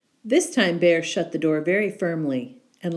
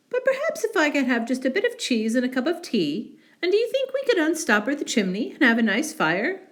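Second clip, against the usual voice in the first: higher, fast eager mouse; 7 semitones higher, faster than usual. Acted. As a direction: mouse presses his luck.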